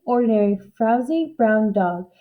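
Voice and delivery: ordinary delivery, nothing marked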